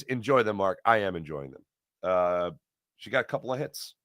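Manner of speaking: ordinary talk, nothing marked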